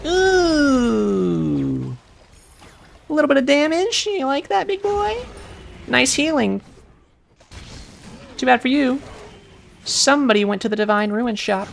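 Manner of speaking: very high, loud taunting rat voice; rat boy voice. Retold or imitated. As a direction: jeer like a cocky little rat. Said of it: "My impression of a rat boy".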